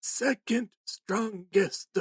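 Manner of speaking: weak insistence. Retold or imitated. Weak old man insisting.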